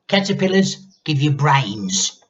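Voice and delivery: put-on an old woman barking orders; grandma ordering the boy about; bossy grandma. A narrator's reading.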